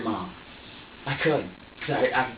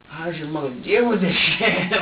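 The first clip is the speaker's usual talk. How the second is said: higher, louder, incredulous question; half-laughing exasperation; exasperated disbelief; 4 semitones higher, 5 dB louder than usual. Retold or imitated. Parent asking a screaming baby about mom, exasperated disbelief.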